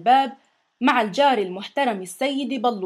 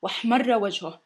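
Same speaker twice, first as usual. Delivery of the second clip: ordinary delivery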